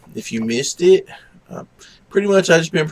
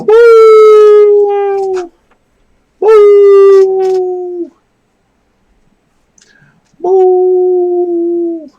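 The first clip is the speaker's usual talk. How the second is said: long, loud high howl; imitated dog howl, held on one note; 11 dB louder, much slower than usual. Shown as a demonstration. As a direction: howl like a dog to get it joining in.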